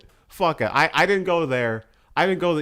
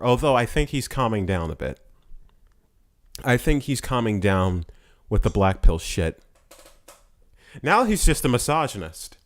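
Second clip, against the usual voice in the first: ordinary delivery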